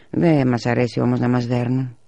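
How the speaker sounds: usual speaking voice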